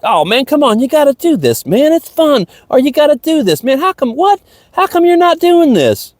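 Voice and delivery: high whiny mocking voice of a pushy friend. Imitating a friend who pressures him to join in, high and whiny. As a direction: pitch it way up like a nagging buddy. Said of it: "my friend's doing it".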